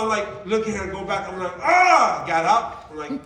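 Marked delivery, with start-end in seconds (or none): 1.6-2.2 sharp yelp, louder and higher; startled mock yelp. Husband startled off the couch, sharp yelp. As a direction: jump up with a yelp. He jumps up at wife.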